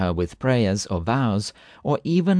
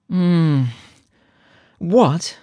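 startled wife waking, higher; woman's voice; 6 semitones higher, 4 dB louder than usual. Acted. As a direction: answer quickly, woken up. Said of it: "A woman's voice articulated rapidly".

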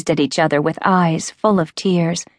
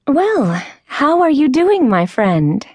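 enthusiastic; 9 semitones higher than usual. A narrator's reading. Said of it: "looking enthusiastically at her friend".

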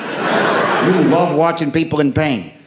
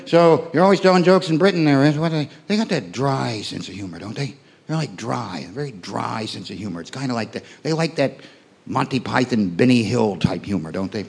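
low, soft, dopey American asking; dim American voice; 5 semitones lower, 5 dB quieter than usual. Retold or imitated. American fan asking the comic about Britain, in a low dopey mumble. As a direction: mumble it like a clueless tourist. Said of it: "The questions I get asked".

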